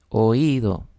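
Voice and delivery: "Oído" with the stress on the í. Shown as a demonstration. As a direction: lean on the middle vowel of "Oído". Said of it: "esta I debe llevar su acento".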